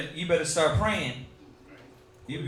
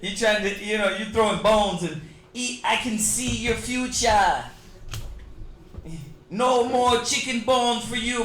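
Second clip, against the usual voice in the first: high mock West Indian fortune teller; hammed-up island seer, teasing; West Indian accent; 4 semitones higher than usual. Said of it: "Forgive my terrible West Indian accent".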